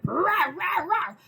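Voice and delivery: high, loud mock growl; imitated dog snarl. Pit bull turning on Chip, loud mock snarl. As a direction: bark it like the angry pit bull. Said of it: "it's like".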